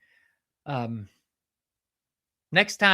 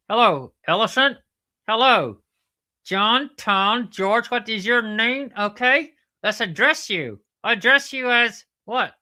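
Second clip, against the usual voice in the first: loud, higher puppet platypus; platypus character calling out; 8 semitones higher, 7 dB louder than usual. Pretend. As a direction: hail someone in a raised cartoon voice. Platypus calls to the elephant.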